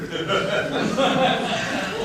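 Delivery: high breathy chuckle; quiet amused laugh. Real, not acted. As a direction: laugh softly at your own joke. Laughs at own joke in court.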